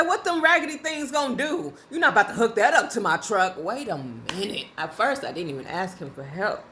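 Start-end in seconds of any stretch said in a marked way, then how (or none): 0.0-3.5 mock trash talk from an old man, much higher and louder; hammed-up scornful old fella; aggressive old man. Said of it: "His aggression was so funny to me".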